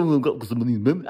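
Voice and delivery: low, rushed, throwaway imitation; offhand mock mumble. Coach mimicking someone who won't love their money, offhand mumble. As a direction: toss it off quickly and low, without enthusiasm. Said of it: "Don't just go".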